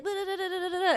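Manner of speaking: put-on high baby babbling; imitating baby babble. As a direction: babble like a baby trying to talk. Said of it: "they're like".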